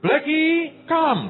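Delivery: high-pitched call to a pet dog. Retold or imitated. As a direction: summon the dog cheerfully.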